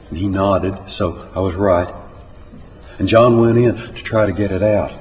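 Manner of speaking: ordinary delivery, nothing marked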